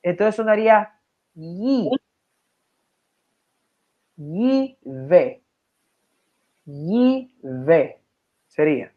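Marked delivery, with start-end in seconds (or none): 0.0-7.4 "yi" said with a clear i vowel; teacher demo of "Yi ve" with an i vowel; Hebrew pronunciation demo. Shown as a demonstration. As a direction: say "Yi ve" slowly, sounding the i. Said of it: "Sería la i".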